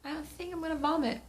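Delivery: high, quick nauseated voice; mock a queasy whimper; half-joking sick whine. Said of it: "I'm like".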